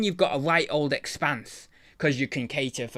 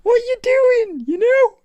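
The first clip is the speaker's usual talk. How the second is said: much higher, louder squeal of disbelief; exasperated outburst; 8 dB louder than usual. Real, not acted. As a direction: throw up your hands at eBay.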